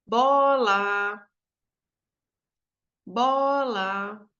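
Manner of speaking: BOLA called out with BO held long; drawn-out musical "Bola", weight on BO; calling the word. Shown as a demonstration. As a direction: call "Bola" as if summoning it, stretching BO. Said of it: "fica mais longo quando eu chamo".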